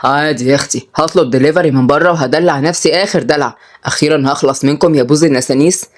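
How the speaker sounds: low grandfatherly banter; wry grandfather. Acted. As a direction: play a cheeky grandpa in a low voice.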